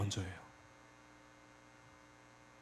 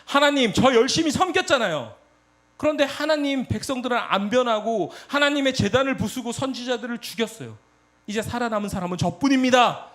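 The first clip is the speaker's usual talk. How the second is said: higher and louder, a worked-up complaint; agitated outburst; 7 semitones higher, 4 dB louder than usual. Retold or imitated. Elijah pouring out his grievance to God, agitated raised voice. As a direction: pour it out at God, voice raised and aggrieved. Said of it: "엘리야가 감정이 격양돼서 대답해요".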